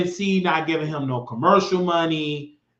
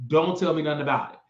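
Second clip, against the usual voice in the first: ordinary delivery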